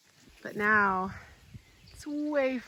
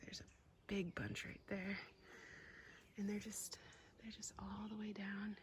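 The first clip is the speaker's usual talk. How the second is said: whisper. Real, not acted. Whispering while touring the garden.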